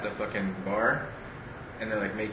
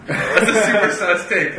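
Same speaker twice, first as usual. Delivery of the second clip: laughing; 9 semitones higher, 9 dB louder, slower than usual. Real, not acted. Laughing at his own reading.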